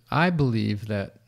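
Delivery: ordinary talk, nothing marked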